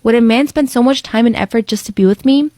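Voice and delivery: ordinary talk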